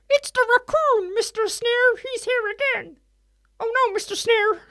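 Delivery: very high, squeaky panicked henchman; cartoon pig impression. Pig henchman warning the boss, very high cartoon voice. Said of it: "And the pigs would say".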